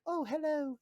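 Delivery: high, lilting British greeting; mock British accent. Suit greeting its wearer, mock British accent. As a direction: put on a dainty British voice. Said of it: "gonna have a British accent".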